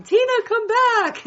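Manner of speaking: put-on high, slow, pleading child's call. Little girl chasing her drunk babysitter calling out. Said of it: "little eleven-year-old ... hill going".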